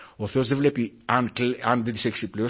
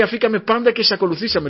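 Peter's words; 10 semitones higher, 5 dB louder than usual. Retold or imitated. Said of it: "λέει ο Πέτρος".

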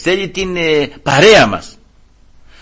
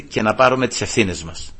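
ordinary talk, nothing marked

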